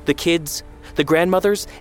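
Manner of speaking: high, indignant questioning that keeps climbing; incredulous challenge. A narrator's reading. Mother confronting son, incredulous and climbing.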